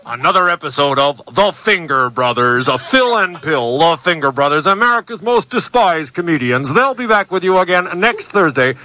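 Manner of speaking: announcer voice. Acted. Mock show sign-off announcement.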